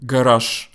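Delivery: garage with a final sh; Russian pronunciation. Shown as a demonstration. Teacher asking learners to listen closely, Russian pronunciation. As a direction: say "garage" in Russian, devoicing the last sound to sh. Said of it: "Listen how I say".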